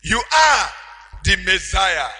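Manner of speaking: loud exclaimed confession; Peter's exclamation. Shown as a demonstration. Peter declaring to Jesus, shouted. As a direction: cry it out as a revelation. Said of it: "repeats Peter's words emphatically".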